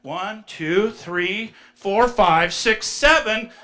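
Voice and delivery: loud triumphant count, slowing at the end; triumphant trophy count; counting trophies. Retold or imitated. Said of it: "He's like".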